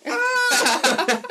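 high, loud burst of laughter; mom's laughing shriek. Retold or imitated. Tired mom cracking up, loud laughing shriek. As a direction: shriek with giggles. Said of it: "And she'd be like".

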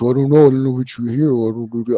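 mock mumble, words blurred together; mumbling. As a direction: talk like a toaster is in your mouth. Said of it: "it sounds like you have a toaster in your mouth".

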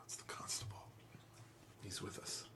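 breathy hushed whisper, barely audible. Acted. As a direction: whisper it so the constable can't hear. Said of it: "And Stephen will whisper".

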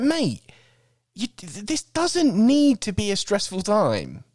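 high, slow mock plea to calm down; high exasperated mock plea; mock pleading. Addressing the stressed man.

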